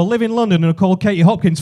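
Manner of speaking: ordinary talk, nothing marked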